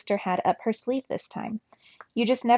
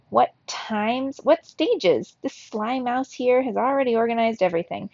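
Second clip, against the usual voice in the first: ordinary delivery, nothing marked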